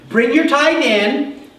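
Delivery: slow, high Bring your tithe in; emphatic. Shown as a demonstration. Preacher pressing the condition on the church, slow and emphatic. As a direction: drag out each word to drive it home. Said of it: "the condition is".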